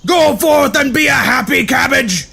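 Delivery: wild, shouted megalomaniac proclamations; manic ranting. Acted. Noodle Boy proclaiming to the crowd, manic shouting. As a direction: bellow nonsense like a mad emperor. Deranged hero rants at a crowd.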